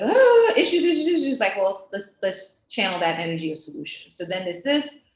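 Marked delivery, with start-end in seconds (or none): 0.0-1.4 high, loud, frantic wail of "issues"; mock-fret in a rising frenzy; mock panic. Mocking the fretting crowd in a loud, high wail. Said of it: "Instead of".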